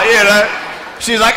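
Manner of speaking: ordinary delivery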